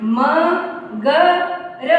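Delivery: मगर as separate letters म, ग, र; sounded out. Shown as a demonstration. Teacher showing how मगर is pronounced, letter by letter. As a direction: clear pause after each of म, ग, र. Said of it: "इसका उच्चारण कैसे करेंगे?".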